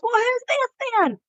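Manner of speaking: very high Southern woman impression; thick Southern accent. Campground guest pointing at the wife, high Southern accent. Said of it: "helps capture the essence to do her accent".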